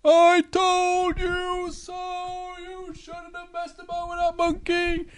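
high, drawn-out distant call, fading; calling out a window. Acted. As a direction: call slowly after a departing friend. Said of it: "Big Bear shouts out of the window".

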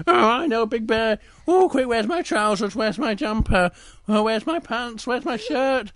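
story bear in a fluster; flustered Little Bear. Acted.

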